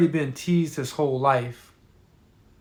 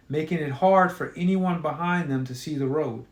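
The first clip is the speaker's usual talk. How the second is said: ordinary delivery, nothing marked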